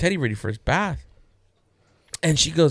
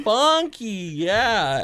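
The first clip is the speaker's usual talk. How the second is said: put-on dad lightly echoing his kid, raised pitch; retold dad reply; 7 semitones higher, much slower than usual. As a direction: play along with the child's word. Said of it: "And I'm like".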